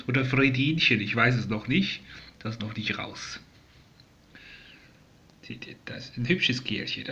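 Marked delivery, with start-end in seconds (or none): 5.4-7.1 whispered admiring aside. Real, not acted. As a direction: whisper it fondly to the viewers. Whispering about calm bird.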